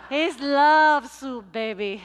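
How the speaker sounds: stretched out, booming; slow loud proclaim. Retold or imitated. Luisa tells pastor about her soup, slow and booming. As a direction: belt it slowly and proudly.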